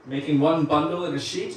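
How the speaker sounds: usual speaking voice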